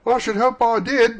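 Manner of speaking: put-on high, loud, boyish retort; lad's voice. A narrator's reading. Lad replying to Scrooge's question, calling up. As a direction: answer up as a young lad. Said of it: "replied the lad".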